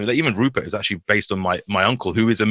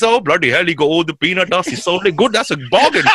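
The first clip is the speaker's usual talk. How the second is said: gleeful bargain hunter; 6 semitones higher than usual. Retold or imitated. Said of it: "he thinks".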